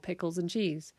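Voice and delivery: ordinary delivery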